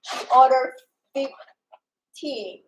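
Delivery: usual speaking voice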